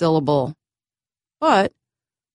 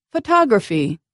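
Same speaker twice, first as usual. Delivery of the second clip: "Photography" with the second vowel longer and stronger; "Photography", second syllable stressed, the others quick and unclear; 2 semitones higher than usual. Shown as a demonstration. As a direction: lean on the "tog" in "Photography". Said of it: "I stressed the second vowel".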